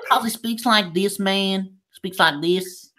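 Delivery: high-pitched mock American accent. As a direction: put on an exaggerated American voice. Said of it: "he speaks in an American accent".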